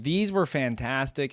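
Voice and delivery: normal talking voice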